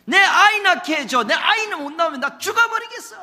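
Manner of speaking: frantic demanding wife; desperate outcry. Acted. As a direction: cry out like a wife at breaking point. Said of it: "이렇게 덤벼드는 겁니다".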